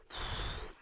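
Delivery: soft, saddened exhale; heavy sigh. Real, not acted. Reactor absorbing the sad news, heavy sigh. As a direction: let out a long quiet breath.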